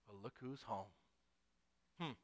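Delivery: soft, ominous Southern greeting; Southern father. Acted. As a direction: play a Southern dad, low and restrained. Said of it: "this is a Southern thing now".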